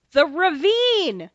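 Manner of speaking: loud, high, drawn-out yell of alarm; urgent warning shout, slow and high; retold scream. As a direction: scream a last-second warning, stretching the words. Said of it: "I scream at the last second".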